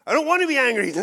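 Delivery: loud, high, fed-up protest; exasperated shout. Retold or imitated. Pleading with God, a loud exasperated cry. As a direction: blurt it out in frustration. Prayer quarrel with the Holy Spirit.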